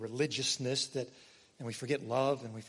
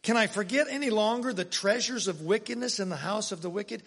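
loud, higher, slowed preaching read; forceful scripture reading; 6 semitones higher, 5 dB louder than usual. Acted. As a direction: proclaim the verse like a prophet.

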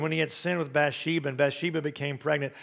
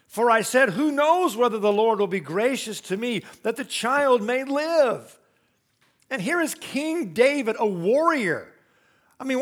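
raised, impassioned sermon delivery, pitch lifted; preaching at full force; 6 semitones higher, 5 dB louder than usual. Acted. Pastor to congregation about King David, fervent preaching. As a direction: press the point home with fervour.

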